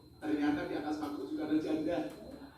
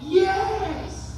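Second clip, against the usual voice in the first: "Yes" tinggi sekali dan keras; jawab bersemangat; 13 semitones higher, 11 dB louder than usual. Retold or imitated. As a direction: lantangkan jawaban dengan yakin. Menjawab dengan semangat. (menjawab dengan teriakan bersemangat)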